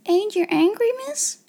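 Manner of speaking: put-on high, halting servant girl; high-pitched anxious question; timid gasp. A narrator's reading. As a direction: ask fearfully, rising to a squeak. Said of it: "she gasped".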